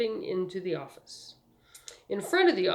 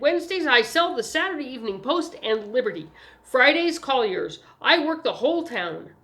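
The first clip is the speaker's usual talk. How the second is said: put-on higher, louder, proud boy's voice; businesslike young paper seller; eager newsboy; 6 semitones higher, 6 dB louder than usual. A narrator's reading. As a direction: brag about the job like a keen kid. Newsboy boasting of his work.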